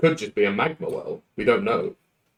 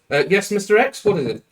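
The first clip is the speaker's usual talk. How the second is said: high, louder eager assistant voice; a higher-pitched office agent replying over the intercom; 10 semitones higher, 4 dB louder, faster than usual. Acted. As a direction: answer brightly up high, like a keen underling.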